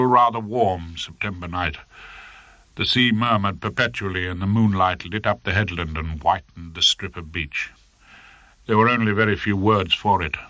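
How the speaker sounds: plain reading voice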